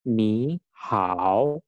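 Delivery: "Ni hao" drawn out, each word dipping and rising; third-tone demo. Shown as a demonstration. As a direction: model "Ni hao" word by word for learners. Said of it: "nadanya nada ketiga". ("Ni hao" dieja pelan dengan nada ketiga)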